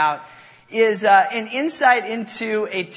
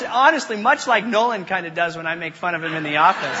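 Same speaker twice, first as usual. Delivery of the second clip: usual speaking voice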